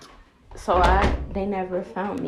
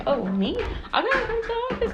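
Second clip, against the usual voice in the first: much higher, loud mock disbelief; disbelieving; 7 dB louder, faster than usual. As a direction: squeak it out like you can't believe it. She reacts to being summoned.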